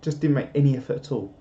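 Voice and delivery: usual speaking voice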